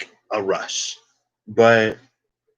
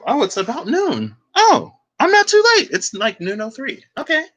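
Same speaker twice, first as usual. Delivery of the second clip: high, loud burst on "Oh, I'm not too late"; surprised relief; 5 dB louder than usual. Real, not acted. To viewers, noticing the time: surprised and relieved. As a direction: catch the clock and light up with relief.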